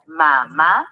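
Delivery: "mamá" en dos sílabas sueltas, ma y má; separado en sílabas. Shown as a demonstration. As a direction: separa "mamá" con una pausa entre sílabas. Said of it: "Al separarla en sílabas". ("mamá" silabeado para enseñar)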